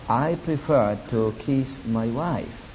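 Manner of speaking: ordinary delivery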